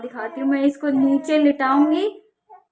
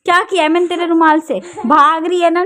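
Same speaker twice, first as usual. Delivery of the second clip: loud, higher annoyed questioning; scolding; 4 semitones higher, 8 dB louder than usual. Real, not acted. Mother scolds fleeing child. (ऊँची आवाज़ में झिड़कना)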